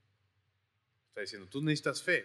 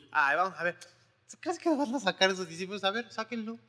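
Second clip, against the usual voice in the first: higher, sneering challenge; mocking taunt; 7 semitones higher than usual. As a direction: goad the disciples mockingly. Preacher mimics taunting crowd.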